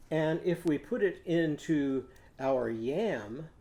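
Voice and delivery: ordinary delivery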